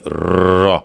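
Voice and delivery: र slowly with a tapped r like Urdu रे; Urdu re sound. Shown as a demonstration. Teacher modelling the tapped र sound. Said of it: "Urdu के हर्फ रे की आवाज़".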